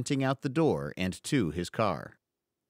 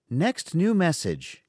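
higher, slow automated phone voice; robot voice reading the greeting; robotic voicemail voice; 7 semitones higher, 3 dB louder than usual. Acted. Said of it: "the voicemail went on".

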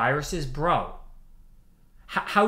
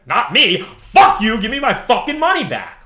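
loud, furious telling-off; angry shout; 6 semitones higher, 11 dB louder than usual. Retold or imitated. Shouting down the chip company over withheld money, furious. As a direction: bark it at the supplier who kept your money. Defiant retort to chip supplier.

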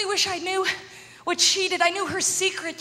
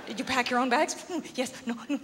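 low, much softer mock exchange; mock security check; 8 semitones lower, 10 dB quieter than usual. Comic acts out airport check-in question, low and soft. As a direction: act out the desk question and shifty reply quietly.

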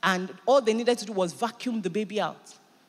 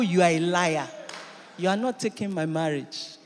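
rising sermon declaration; full-force preaching; 7 dB louder than usual. Acted. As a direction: preach it out over the music.

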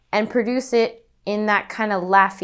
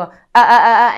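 throaty ah; 11 dB louder than usual. Shown as a demonstration. Voice teacher imitating students' loud throaty ah. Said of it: "a lot of times they'll go".

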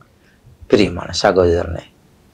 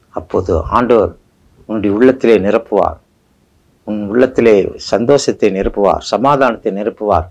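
ordinary talk, nothing marked

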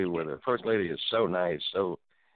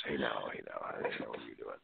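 much lower, softer put-on smooth voice; 12 semitones lower, 11 dB quieter than usual.

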